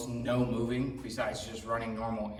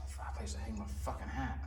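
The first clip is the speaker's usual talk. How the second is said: whispering; 9 dB quieter than usual. Real, not acted. Muttering while setting up camera.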